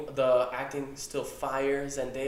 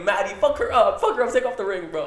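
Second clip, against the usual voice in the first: loud, high egging on; hype cheering; 7 semitones higher, 8 dB louder, faster than usual. Retold or imitated. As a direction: cheer her into the fight. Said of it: "I'm like".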